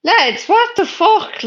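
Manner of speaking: slow, high-pitched disbelief; exasperated disbelief. Real, not acted. Streamer reacting to a viewer's message, exasperated. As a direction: draw it out high, appalled.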